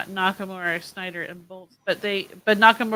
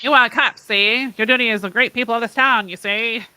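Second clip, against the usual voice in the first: put-on louder old-time tough guy, see?; 1920s gangster voice; 2 semitones higher, 8 dB louder than usual. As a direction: ham up a 1920s mobster. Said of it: "that doesn't make it more nineteen twenties".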